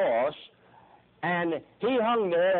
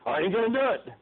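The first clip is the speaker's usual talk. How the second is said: put-on defiant kid; 6 semitones higher than usual. Mimics a defiant kid refusing.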